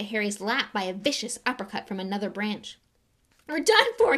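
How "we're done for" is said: high, loud despairing moan. Acted. Ron moaning to Harry as the roof sags. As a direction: wail that it's over. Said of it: "he moaned".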